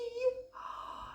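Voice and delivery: high sharp intake of breath; frightened gasp. Acted. Podgy hearing the bear, frightened gasp.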